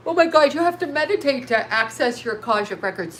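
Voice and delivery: talking through a yawn; words stretched by a yawn. Real, not acted. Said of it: "there I go yawning again".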